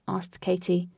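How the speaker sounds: ordinary delivery, nothing marked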